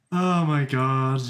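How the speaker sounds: ordinary talk, nothing marked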